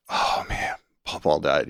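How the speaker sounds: hushed, breathless dread; a frightened murmur to yourself; dread whisper. Retold or imitated. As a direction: whisper it as the bad news sinks in. Dreading grandfather's death.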